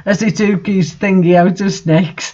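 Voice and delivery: ordinary talk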